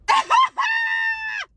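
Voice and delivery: high, loud burst of disbelieving laughter. Real, not acted. Narrator laughing at the man's view on dates, loud disbelieving laugh. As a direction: crack up at how absurd it is.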